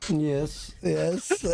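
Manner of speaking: usual speaking voice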